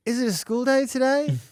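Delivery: put-on high, curious child asking. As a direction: ask like a small kid. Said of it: "she's like".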